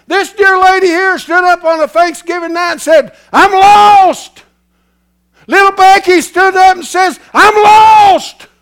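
high, loud revival shout; full-force preaching of the testimony; shouting preacher. Acted. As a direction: cry it out to the whole church. Shouted retelling of confessions.

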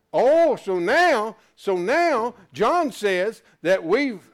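loud, high fervent preaching; preaching at full force. Acted. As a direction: drive the point home to the congregation. Preacher raising the pitch.

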